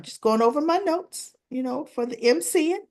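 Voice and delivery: high-pitched innocent cover-up; feigned casualness. Retold or imitated. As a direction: play the mother acting as if nothing happened. Mother covers up what she did.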